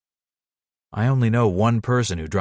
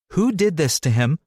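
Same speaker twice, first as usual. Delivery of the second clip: higher, sterner teacher demand; 6 semitones higher than usual. Acted. She questions the guilty werewolf pack with a teacher voice. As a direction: demand it like a teacher scolding the playground. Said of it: "I demand in my best teacher voice".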